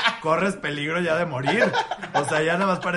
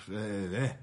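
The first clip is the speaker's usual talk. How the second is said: ordinary talk, nothing marked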